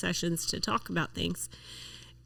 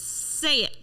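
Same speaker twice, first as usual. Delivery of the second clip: high, raised command; retold louder coach; 8 semitones higher than usual. Coach urging student to say it, getting louder. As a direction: imitate a coach calling out an order. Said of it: "he keeps getting louder".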